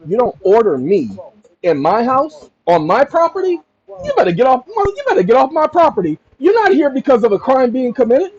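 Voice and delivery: taking control. Pretend. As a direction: take charge of the conversation. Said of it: "I'm not yelling at him. I'm taking control".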